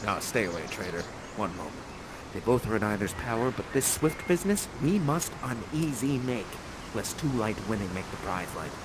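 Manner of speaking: scheming aside. Acted. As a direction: plot under your breath. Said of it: "Prospero aside, plotting".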